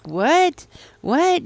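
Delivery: high, loud, incredulous double what; shocked disbelief. Retold or imitated. Person overhearing praise, voiced in shocked disbelief. Person hears someone wants to invest.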